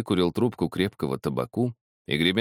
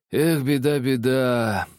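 grieving lament; 7 semitones higher, 4 dB louder, slower than usual. A narrator's reading. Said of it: "говорил, сокрушаясь Тихонов".

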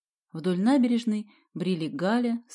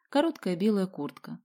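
plain reading voice

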